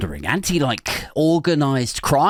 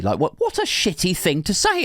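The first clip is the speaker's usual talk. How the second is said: ordinary talk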